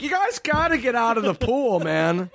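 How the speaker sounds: high dopey guard; mild order from a lazy guard. Retold or imitated. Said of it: "He's like".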